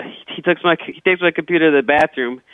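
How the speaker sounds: normal talking voice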